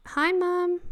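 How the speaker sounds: high, little voiced pet greeting; cute pet voice. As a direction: voice the pet sweetly, lightly. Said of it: "Mister Yesterday saying".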